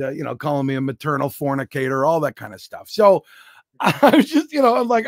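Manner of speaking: normal talking voice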